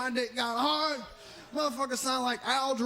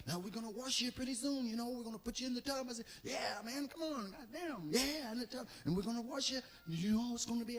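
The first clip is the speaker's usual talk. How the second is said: much softer, soothing caretaker; soft gentle voice; 9 dB quieter than usual. Retold or imitated. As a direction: speak softly like a patient nurse. Larry Murphy talks to patient.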